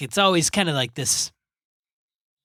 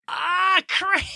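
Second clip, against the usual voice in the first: sharp pained yelp, much higher; cramp pain. Real, not acted. Cramp hits again while reading, real pained yelp. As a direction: cry out as the cramp grabs again. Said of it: "I just got a hellacious cramp in my leg".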